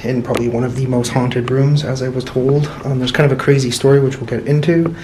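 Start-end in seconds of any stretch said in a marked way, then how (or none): none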